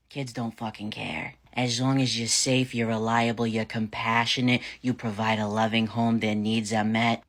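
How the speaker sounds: ordinary delivery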